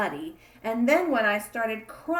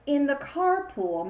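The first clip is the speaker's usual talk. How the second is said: ordinary delivery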